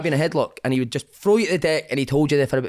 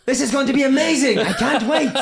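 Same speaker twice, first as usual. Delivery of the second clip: high-pitched, gleeful exclamation; excited imitation; 9 semitones higher, 3 dB louder than usual. Demoted player thrilled to join reserves, excited. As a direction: gush with over-the-top delight. Acted delight at joining reserves.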